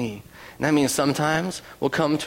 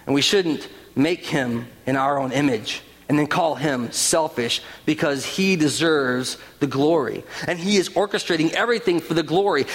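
ordinary talk

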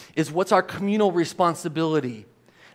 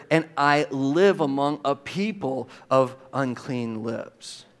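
slow, low, deliberate reading; word-by-word reading; 5 semitones lower, slower than usual. Shown as a demonstration. Preacher shows listeners Isaiah's own words, slow and deliberate. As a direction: spell out the verse slowly, dropping at the end. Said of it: "Look at the word".

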